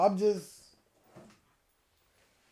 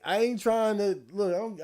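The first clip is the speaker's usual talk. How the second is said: ordinary talk, nothing marked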